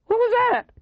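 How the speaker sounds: startled, high cracking question; frightened high-pitched yelp. A narrator's reading. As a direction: let fear crack the voice upward. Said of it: "his voice cracking".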